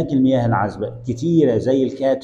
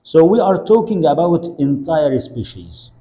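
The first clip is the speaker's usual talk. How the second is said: slow emphatic sentence; 5 semitones higher, 4 dB louder, much slower than usual. Shown as a demonstration. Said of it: "teacher models key sentence".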